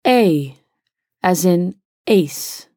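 "A" said as the long ay of "ace", slow and careful; vowel demo. Shown as a demonstration. Teacher models the first vowel symbol for learners, slowly. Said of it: "The first symbol is pronounced".

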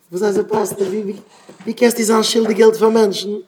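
high-pitched, protesting challenge; acted incredulous objection; indignant questioning. Acted objection to owing people money.

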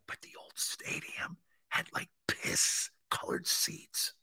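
slow, soft, voiceless whisper. Real, not acted. Fan describing the old stadium, whispering slowly. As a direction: share it in a drawn-out hush. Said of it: "I don't know why I'm doing that".

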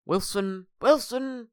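high-pitched character call; Jim from Friday Night Dinner. Acted. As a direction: call out in a high, badly done impression. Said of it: "do a very good Jim from Friday Night".

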